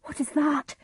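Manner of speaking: high, alarmed question; startled cry. A narrator's reading. Macbeth hears knocking at the castle gate, startled cry. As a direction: jump at a sudden noise. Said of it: "cried Macbeth".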